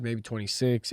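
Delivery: ordinary delivery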